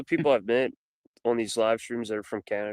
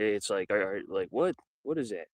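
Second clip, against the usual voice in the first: normal talking voice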